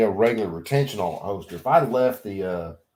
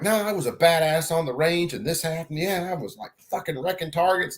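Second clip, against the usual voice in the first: higher, hammed-up tough guy boast; mock bragging shooter; 8 semitones higher than usual. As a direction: play a show-off bragging about the range. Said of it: "anybody else telling this shit would be like".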